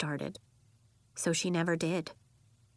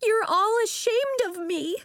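put-on high, louder hurt child's cry; upset little girl, pitched way up; tearful accusation; 5 dB louder than usual. A narrator's reading. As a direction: accuse them all, near tears. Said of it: "Her eyes teared up".

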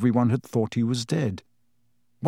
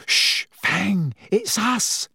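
hushing Hermione; 8 semitones higher, much slower than usual. A narrator's reading.

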